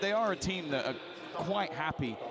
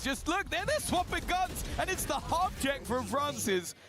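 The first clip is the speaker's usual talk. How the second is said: excited; 8 semitones higher than usual. Real, not acted. Excited pointing out replay.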